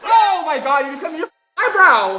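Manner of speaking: shrieking with laughter; loud laughing shock. Real, not acted. Latex lands in eyebrow.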